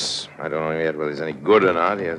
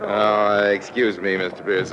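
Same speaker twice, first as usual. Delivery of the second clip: ordinary talk, nothing marked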